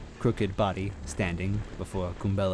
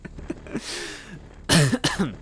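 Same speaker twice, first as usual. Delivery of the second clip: wheezy cough; 4 dB louder than usual. A narrator's reading. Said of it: "panting his way over".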